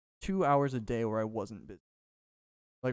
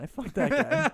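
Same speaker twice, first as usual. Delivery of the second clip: laughing mock boast; 12 semitones higher, 8 dB louder than usual. Acts out boastful guy, laughing.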